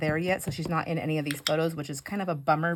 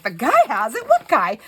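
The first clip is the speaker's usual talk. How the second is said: higher and louder, rising in alarm, echoing what friend just said; alarmed disbelief; 6 semitones higher, 9 dB louder than usual. Retold or imitated. Retelling the airport search, incredulous echo. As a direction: repeat it back in shock.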